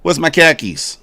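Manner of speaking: hammed-up Bostonian hunting for car keys; Boston accent. Joking with chat about Boston speech, hammed-up Boston accent. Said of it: "But in Boston".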